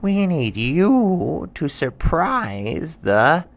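high, slow, drawn-out animal voice; animals asking. Acted. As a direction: stretch it out high and pleading. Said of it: "And they said".

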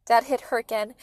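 plain reading voice

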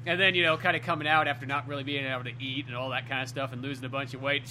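ordinary talk

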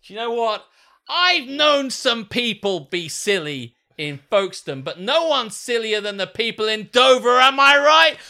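high, loud arena speech building to a shout; mock crowd-baiting promo. Mum on the mic taunts Dover, high shouted mock promo. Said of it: "She gets on the mic".

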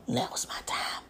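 breathy whispered aside, as if sneaking off; a guilty whisper to herself. Retold or imitated. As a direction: hush it down to a secret whisper. Self-talk quote pulling away from God.

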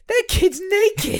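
mock horrified mother-in-law; shocked old lady. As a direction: gasp it out like a scandalised grandmother. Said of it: "I just broke when she's like".

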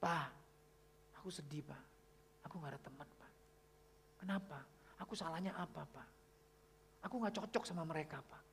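soft, subdued retold sadness; imitating tearful daughter. Daughter confiding in her father about having no friends, softly sad. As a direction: voice a sad child quietly, without real crying. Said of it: "Livia, kenapa kamu nangis?".

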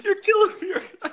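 helpless laughter at the story; real laughter bursting out; cracking up. Real, not acted. Cohost cracking up.

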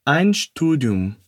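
slow, careful German for students to repeat; slow model repetition. Shown as a demonstration. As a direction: spell it out slowly for the learner. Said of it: "Repete comigo". (fala devagar para o aluno repetir)

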